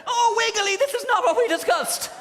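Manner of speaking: very high, loud, put-upon wail; high prissy scolding. Acted. Dressmaker scolding silkworm Wiggly, high and shrill. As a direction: shriek at the worm in prissy despair.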